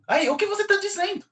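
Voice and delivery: high, fast puzzled voice of a confused listener; mock confused questioner. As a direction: squeak out the confusion of someone who can't follow. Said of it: "as pessoas me perguntam".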